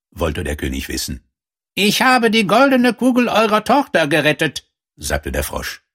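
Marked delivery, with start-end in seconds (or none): 1.7-4.7 very high frog character voice; talking frog. A narrator's reading. Frog tells the king of his rescue, high creature voice. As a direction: plead the case up high, sustained pitch.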